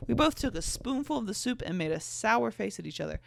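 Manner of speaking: ordinary delivery, nothing marked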